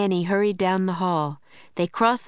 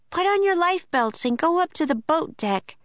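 put-on girl shouting; 11 semitones higher than usual. A narrator's reading. Annie warns card players and dancers, high urgent shout. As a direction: urge the whole room at once. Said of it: "Annie shouted".

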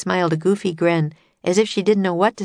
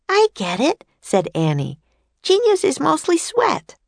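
put-on high, eager little girl's voice; bright young girl; 12 semitones higher than usual. A narrator's reading. Annie introducing herself and Jack to famous inventors, eager girl voice. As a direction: chirp it like an excited young girl.

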